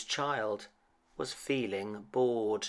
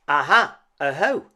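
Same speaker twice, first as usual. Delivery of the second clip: loud, high triumphant exclamation; excited discovery; 5 semitones higher, 13 dB louder than usual. Acted. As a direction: cry out like you've found a clue. Child discovers marks in snow.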